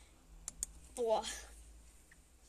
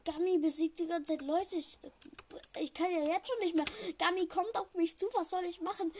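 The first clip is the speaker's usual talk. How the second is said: high, loud panicked rush; while running; 5 semitones higher, 5 dB louder than usual. Real, not acted. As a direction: blurt it out fleeing in panic. Monster chasing player in game.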